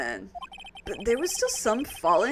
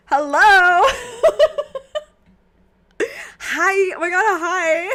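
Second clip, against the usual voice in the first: high, loud laughing hello; laughing greeting; 8 semitones higher, 9 dB louder than usual. Real, not acted. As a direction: cackle through the hello.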